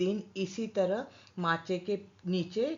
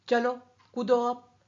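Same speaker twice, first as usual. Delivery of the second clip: loud, higher push to jump; urging shout; 6 semitones higher, 7 dB louder than usual. Acted. Balu at the well urging the boy to jump, loud shout. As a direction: shout him over the edge.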